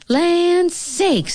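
high-pitched motherly exclamation; fussy high mama bear voice. A narrator's reading. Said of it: "said Mama Bear".

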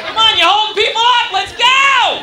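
mock conductor hollering to hurry up; rising impatient yell at a stuck man. Conductor yells at stuck passenger, mock shouting.

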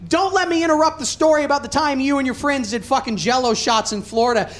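normal talking voice

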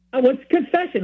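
high-pitched, happy announcement; happy news. Retold or imitated. Friend reports his confession to the speaker, sounding happy. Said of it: "he kinda sounds really happy".

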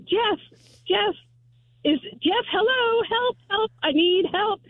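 high-pitched mock cry for help; re-enacting a plea from behind a locked door; retold call for help. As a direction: call out for rescue without really shouting. Retells calling from locked porta potty.

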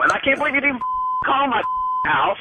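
high-pitched, incredulous protest; upset disbelief. Real, not acted. Upset prank victim reacting to news.

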